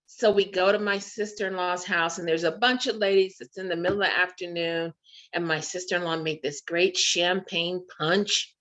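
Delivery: normal talking voice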